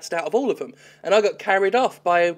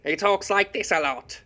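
lightly voiced stern witch hunter; a hint of the character's gruff tone; imitating Witch Hunter; 4 semitones higher than usual. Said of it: "he sounds brilliant".

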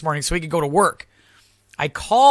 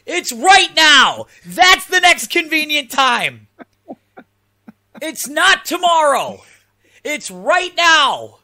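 much higher, louder, slow enraged shouting; furious yell; 9 semitones higher, 4 dB louder, slower than usual. Retold or imitated. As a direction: scream it word by word at the scheduler. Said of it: "I was fuming mad".

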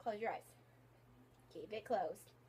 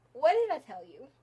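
loud, playful scolding question; half-joking telling-off; mock scolding; 15 dB louder than usual. Friend who opened her eyes gets a playful telling-off. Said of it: "I'm so mad right now".

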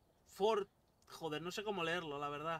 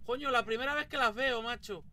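excited; 5 semitones higher, 5 dB louder than usual. Real, not acted. First time seeing footprints.

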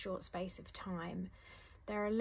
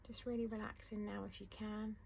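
soft, unhurried meditation voice with long pauses; guided meditation; 6 dB quieter than usual. Acted. Teacher guiding listeners through a letting-go meditation, soft and calm. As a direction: ease the listener gently into calm.

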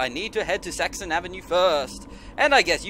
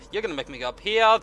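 ordinary delivery

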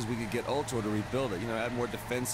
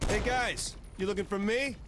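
high, projected holler; pitched-up goading shout; taunting call-out; 7 semitones higher than usual. Acted. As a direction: call out cockily to the enemy. Calls out to hostile cops.

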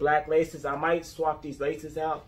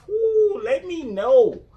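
high, louder hype call; excited whoop; 12 semitones higher, 6 dB louder, slower than usual. Real, not acted. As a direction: hype up the viewers. Hype outro to viewers.

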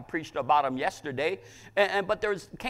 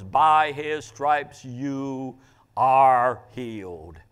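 slow, drawn-out, louder quote; solemn pronouncement; 3 semitones lower, 7 dB louder, much slower than usual. Retold or imitated. Preacher quoting words heard in a vision, solemn and slow. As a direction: pronounce it slowly and weightily, stretching each word.

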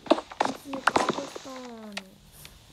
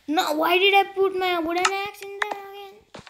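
exasperated outburst; 8 semitones higher, 6 dB louder than usual. Real, not acted. Notices axe used up.

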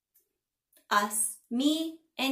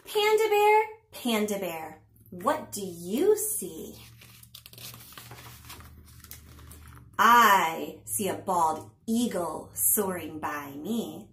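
slow, sing-song picture-book reading; punchy, drawn-out storytime narration; read-aloud to children; 5 dB louder, slower than usual. A narrator's reading. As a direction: read it to little ones with big pitch swings and pauses.

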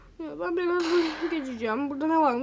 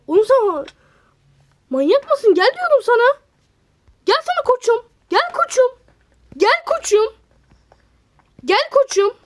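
tiz ve yüksek sesle "gel" diye bağırış; sabırsızca uzaktakini çağır; seslenerek çağırma; 9 semitones higher, 6 dB louder than usual. Real, not acted. Oyundaki yaratığı çağırıyor. (yüksek ve tiz sesle seslenme)

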